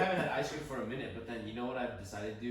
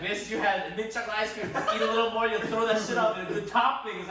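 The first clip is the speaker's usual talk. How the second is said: mock scolding; 9 semitones higher, 10 dB louder than usual. Mom scolding, acted.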